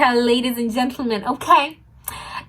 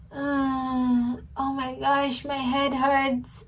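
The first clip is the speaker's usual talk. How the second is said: softly moaning as if hurt, put-on; fake weak moan; 8 dB quieter, much slower than usual. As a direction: pretend to be sick and barely able to speak. Faking being unwell.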